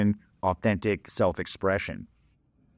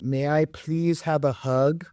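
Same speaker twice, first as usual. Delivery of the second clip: slow, higher, timid little plea; meek nice guy; 5 semitones higher, slower than usual. Retold or imitated. Nice guy at her door begging for a hug, meek and slow. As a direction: ask like a nervous, ineffectual guy at the door. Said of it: "he goes".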